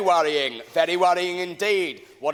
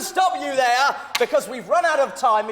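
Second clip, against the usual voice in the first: shouting over him; 5 semitones higher than usual. Acted. Host shouting over a rambling guest. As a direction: shout him down to end the segment.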